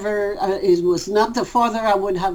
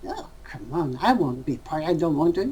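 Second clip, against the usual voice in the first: much softer and lower, a mild reluctant grumble; reluctant ego voice; 5 semitones lower, 5 dB quieter, faster than usual. Retold or imitated. Teacher voicing his ego refusing the party, soft mock sulk. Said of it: "I say".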